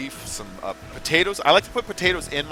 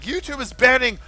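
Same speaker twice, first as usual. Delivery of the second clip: high, loud mimicry of alarmed posts; mock alarm; 10 semitones higher, 6 dB louder than usual. Streamer reading out alarmed posts about YouTube, mock alarm. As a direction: sound the alarm as the outraged crowd.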